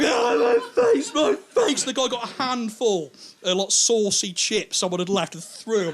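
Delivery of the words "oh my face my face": very high, loud wail; pained screaming. Acted. Kid hit in the face by a pie, screaming. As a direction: shriek it out in hammed-up agony.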